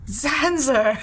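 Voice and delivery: excited greeting. Acted. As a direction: call out to a friend gladly. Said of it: "all excited, turn around".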